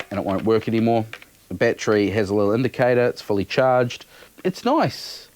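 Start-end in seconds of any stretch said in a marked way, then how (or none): none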